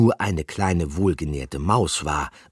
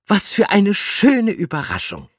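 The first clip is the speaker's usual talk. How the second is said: high, loud joyful exclamation; delighted call; 8 dB louder than usual. A narrator's reading. Gruyère waves to the rats and calls out, loud delighted cry. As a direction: greet them with delight. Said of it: "rief sie".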